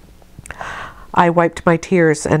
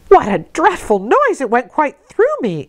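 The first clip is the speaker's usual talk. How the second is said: a higher, startled servant's cry; shocked exclamation; 8 semitones higher than usual. A narrator's reading. Maid Abbott reacting to the scream, mildly shocked. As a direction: recoil at the noise, lightly voiced. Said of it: "exclaimed Abbott".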